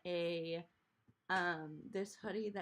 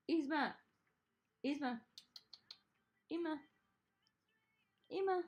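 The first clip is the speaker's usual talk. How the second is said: calling a cat; 8 semitones higher than usual. Real, not acted.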